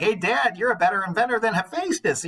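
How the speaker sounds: high, loud, teasing impression of a brash kid; lightly mock the boy's bragging; mock cocky boast. Teacher imitating Icarus flattering his dad, mocking boast. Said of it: "he also was like".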